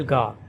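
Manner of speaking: ga said alone, the stressed syllable of oregano. Shown as a demonstration. Teacher isolating the British stressed syllable ga. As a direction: isolate ga, open ah. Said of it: "with the stress on the third syllable".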